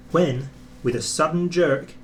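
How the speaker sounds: usual speaking voice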